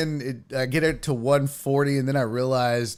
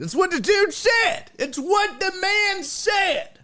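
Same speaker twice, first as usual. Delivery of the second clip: squeaky high mock quote, drawn out; mock dude voice; slower than usual. Repeating the grill guy's advice to chat, squeaky mock voice. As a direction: ham up a silly high voice quoting the guy.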